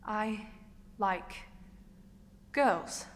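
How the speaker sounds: put-on "I like girls" one word at a time; long pause between each word of "I like girls"; deliberate confession.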